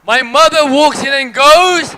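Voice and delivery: loud, slow, drawn-out build; hype-man call to the audience; building to a big loud call. Shown as a demonstration. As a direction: belt the line out to rouse the crowd. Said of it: "Not bad. That's about level five".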